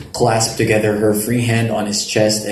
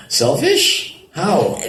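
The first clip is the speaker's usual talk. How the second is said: sharp high disbelieving question; surprised; 8 semitones higher, much slower than usual. Acted. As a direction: leap up in pitch, not buying it. Surprised at her remark.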